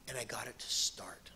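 whispered, amazed; quiet wonder at the engine; hushed awe. Real, not acted. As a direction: breathe it out in wonder. Hushed moment of success.

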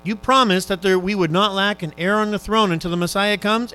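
usual speaking voice

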